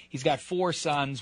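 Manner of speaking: usual speaking voice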